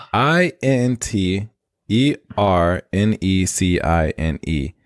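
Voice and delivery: slow letter-by-letter spelling. Shown as a demonstration. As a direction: spell out each letter slowly, evenly. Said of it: "spell it, please".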